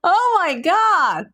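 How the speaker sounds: real joyful high shout; thrilled yell, stretched out slowly; excited. Real, not acted. Donation goal met live.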